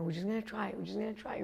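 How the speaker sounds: soft, reassuring murmur; hushed, calming repetition; soothing self-talk. Acted. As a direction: gently coax the frightened inner voice. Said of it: "So I'm telling her".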